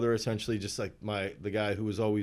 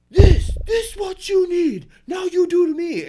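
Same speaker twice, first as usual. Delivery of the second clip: much higher and louder, slow broken English; imitating angry coach; 10 dB louder than usual. Athlete mimics furious coach demanding he hit back. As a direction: yell it at him like the coach did. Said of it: "He's like".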